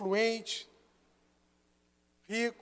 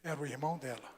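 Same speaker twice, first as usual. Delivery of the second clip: grave reveal; 7 semitones lower, 7 dB quieter, faster than usual. Real, not acted. Revealing the abuser.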